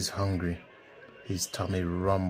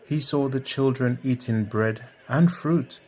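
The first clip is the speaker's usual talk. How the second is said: ordinary delivery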